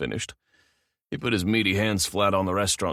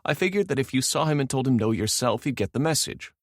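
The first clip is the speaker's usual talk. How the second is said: higher voiced young woman; female assistant voice; 7 semitones higher than usual. A narrator's reading. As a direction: play the assistant, a touch apologetic. Female assistant voiced by male narrator.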